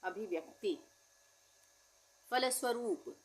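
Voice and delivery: ordinary talk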